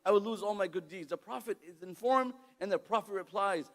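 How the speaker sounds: ordinary talk, nothing marked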